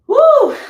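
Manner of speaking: loud high whoop; excited whoop. Real, not acted. Teacher cheering at her class, loud whoop. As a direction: let out a joyful cry.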